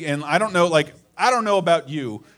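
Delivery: ordinary talk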